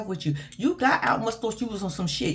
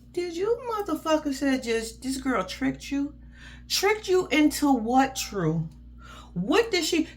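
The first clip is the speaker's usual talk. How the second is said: high, drawn-out indignant question; slow, incredulous challenge; 5 semitones higher, slower than usual. Real, not acted. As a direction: stretch it out in disbelief at his nerve. Incredulous at the man's claim.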